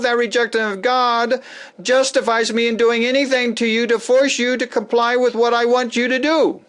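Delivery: a lightly voiced self-righteous controller; arrogant zealot. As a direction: lay down the law from on high. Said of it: "to the overt agenda of".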